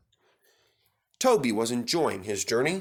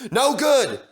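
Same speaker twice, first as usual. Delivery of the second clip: high and loud; loud, high flat refusal; sharp refusal; 12 semitones higher, 7 dB louder than usual. A narrator's reading. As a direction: snap it down.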